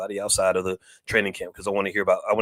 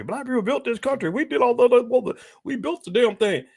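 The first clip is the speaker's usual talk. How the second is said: much higher, proud mocking voice; mock boastful crowd; 13 semitones higher than usual. Mocking folks who brag about building America, high and proud. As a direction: lampoon the boast in a pitched-up voice.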